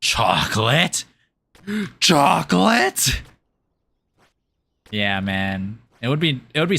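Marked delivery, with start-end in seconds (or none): 0.0-1.0 slow questioning try at a rasp; cartoon rasp attempt. Trying a SpongeBob character rasp, slow question. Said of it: "I don't know how to do that rasp". 1.7-3.2 gasp, then higher, slow amazed chocolate; gasping cartoon voice. Trying a SpongeBob character again, gasping higher and slow. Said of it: "I don't know how to do that rasp".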